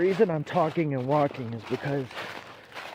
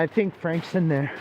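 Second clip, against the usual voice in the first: ordinary talk, nothing marked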